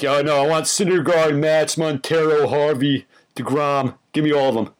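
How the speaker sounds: put-on New York accent. Mimicking greedy Cubs fans on Reddit, mock New York accent. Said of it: "why they have a New York accent".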